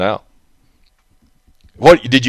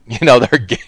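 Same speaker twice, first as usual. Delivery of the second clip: laughing; 2 semitones lower than usual. Real, not acted. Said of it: "I ought not laugh".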